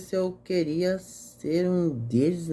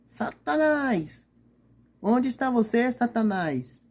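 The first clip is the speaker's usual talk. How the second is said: calling for her lost dog; 6 semitones higher, slower than usual. Acted. Old lady looking for her dog.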